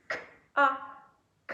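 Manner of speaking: K as a bare click, A, then a drawn kaa; syllable sounded out. Shown as a demonstration. Teacher decodes the second syllable, K and A apart then joined. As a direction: leave gaps between K and A, then blend. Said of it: "et encore ici".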